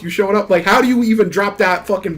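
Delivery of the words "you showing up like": usual speaking voice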